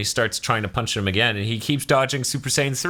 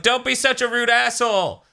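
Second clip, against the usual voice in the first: high, squeaky scolding; scolding King Kai; 14 semitones higher than usual. Retold or imitated. King Kai scolding Goku for rudeness, high squeaky scold. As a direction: snap at him in a shrill cartoon scold. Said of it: "King Kai's like".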